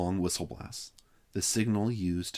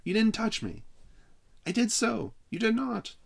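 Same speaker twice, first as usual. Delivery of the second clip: arguing; 3 dB louder than usual. Acted. Acted quarrel over tag.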